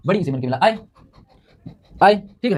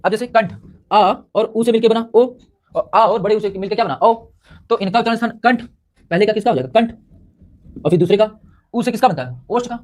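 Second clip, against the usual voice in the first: normal talking voice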